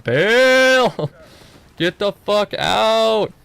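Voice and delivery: high, loud yell breaking into a laugh, slow; panicked yell. Retold or imitated. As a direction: shout the retreat order, laughing at the mess. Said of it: "I'm just like".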